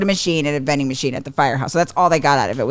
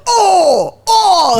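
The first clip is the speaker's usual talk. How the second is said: high, loud imitated groan; disappointed kid's "oh"; 13 semitones higher, 5 dB louder than usual. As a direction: mimic a child's big letdown. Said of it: "he'd be like".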